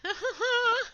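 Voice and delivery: high nervous giggle; nervous laugh. Real, not acted. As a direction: giggle in real fright. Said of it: "kill this spider".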